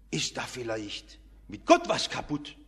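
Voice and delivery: low, insinuating voice of doubt; zweifelnde Stimme. Acted. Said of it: "dann kam die Stimme".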